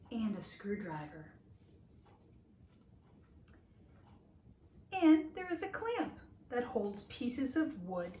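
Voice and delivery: lively, expressive picture-book reading; reading to children. Acted. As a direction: read it like a storybook to little listeners.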